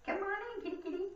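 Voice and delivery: high-pitched coaxing kitty call; calling a cat. Pretend. Woman coaxing the cat to come in, high kitty call.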